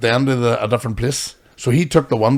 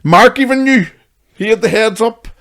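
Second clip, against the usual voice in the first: excited; 9 semitones higher, 7 dB louder than usual. Real, not acted. Excited retelling.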